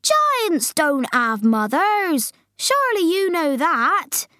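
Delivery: high, drawn-out indignant giant; BFG protesting. Acted. Giant telling Sophie giants have no mothers, protesting.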